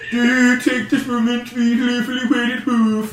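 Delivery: high singsong mock-Swedish wedding officiant; Swedish Chef. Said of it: "there is a Swedish Chef slash fiction".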